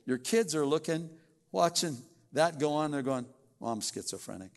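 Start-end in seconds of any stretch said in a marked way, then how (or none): none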